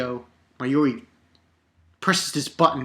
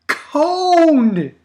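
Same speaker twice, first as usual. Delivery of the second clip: high, loud fan cry of the name; hyped shout; 12 semitones higher, 9 dB louder than usual. Real, not acted. To viewers, hyped by the reveal, shouting the name high. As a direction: scream it like a thrilled fan.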